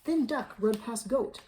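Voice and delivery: usual speaking voice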